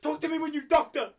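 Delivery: put-on high, loud scolding parent accent. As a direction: yell like a strict accented parent. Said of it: "stereotype for all the voices".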